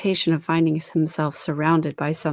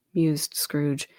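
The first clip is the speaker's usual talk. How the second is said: plain reading voice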